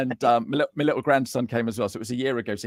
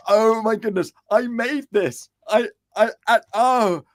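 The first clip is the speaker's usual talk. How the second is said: excited disbelief; 7 semitones higher than usual. Retold or imitated. Retelling opening his first book.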